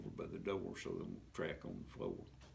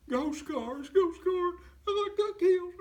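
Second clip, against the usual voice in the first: much higher and louder, a panicked bawling cry; terrified wailing; 11 dB louder than usual. Acted. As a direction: wail it out like a scared man who thinks he died. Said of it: "just squalling his eyes out".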